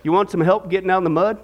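put-on low, gentle old man; gentle reply. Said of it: "the old man gently replied".

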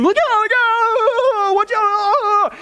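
high, drawn-out panicked squeal; screaming wife. Acted. Wife warning her husband at the wheel, hammed-up scream. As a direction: shriek the warning in mock panic. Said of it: "his wife starts to scream".

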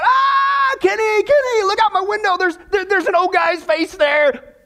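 high-pitched panicked cry, drawn out; mock scream. Al yelling to Kenny about the face, mock scream. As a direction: act out a terrified scream in a joke. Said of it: "he screams out".

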